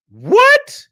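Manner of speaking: loud, very high-pitched "what" of disbelief; incredulous outburst. Real, not acted. Reactor throws the mother's excuse back at her, incredulous.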